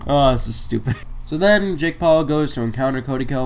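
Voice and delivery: ordinary delivery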